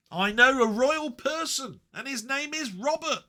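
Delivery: a hammed-up royal proclamation for Robert; mock royal announcement. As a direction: announce him like a herald at court. Grand announcement of a royal friend.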